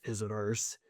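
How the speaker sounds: low and soft, one word to himself; hushed wary mentor. Acted. Mentor notices someone in the courtyard, hushed and wary. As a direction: say it softly, on the alert. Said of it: "and he goes".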